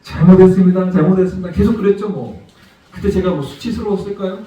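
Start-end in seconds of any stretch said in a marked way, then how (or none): 0.0-1.5 much louder repeated apology, head bowed; loud pleading. Retold or imitated. Bowing father pleading with the raging driver, loud. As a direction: beg forgiveness over and over, loudly. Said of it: "고개 숙이고".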